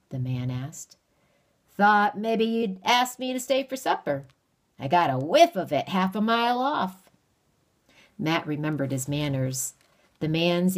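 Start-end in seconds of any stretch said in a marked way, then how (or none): 1.7-7.1 folksy stranger. Acted. Stranger voice held.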